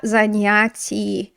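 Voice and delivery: Занятия said slowly, ending in a clear ya; drawn-out demo of Занятия; slow model word. Shown as a demonstration. Teacher models the plural ending for students, slowly. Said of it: "Do you remember that?".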